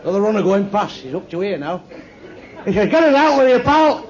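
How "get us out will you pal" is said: louder, higher cry for help; mock-urgent shout for rescue; urgent plea. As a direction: play a sinking man begging a passer-by. Sinking runner pleads for rescue.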